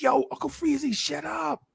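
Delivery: high, hushed teasing voice of the gym crowd; mocking crowd voice. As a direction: tell him to shut up like a jeering onlooker. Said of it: "everybody was like".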